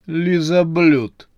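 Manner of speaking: high, loud, drawn-out insult; stretched, contemptuous jibe; scornful snort. A narrator's reading. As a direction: toss out the name with disdain. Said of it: "фыркнула Елена Анатольевна".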